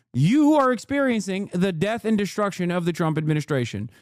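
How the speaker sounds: ordinary talk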